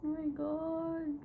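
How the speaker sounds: high, slow, crying with relief; crying softly as it sinks in; tearful joy. Real, not acted. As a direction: overcome with happy tears. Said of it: "this is my first time crying".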